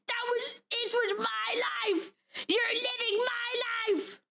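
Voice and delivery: high, slow, hammed-up lament; drawn-out jealous melodrama; mock jealous wail. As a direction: wail out the words in mock envy. Mock jealousy over a game character.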